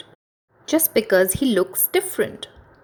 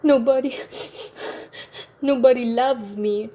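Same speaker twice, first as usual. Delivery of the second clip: mild sob; 6 semitones higher than usual. A narrator's reading. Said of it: "he sobbed".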